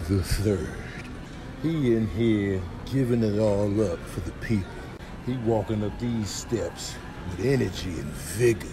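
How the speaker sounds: low, quiet deadpan commentary; hushed play-by-play of someone doing the most; mock narrator. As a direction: narrate it softly, low in your range.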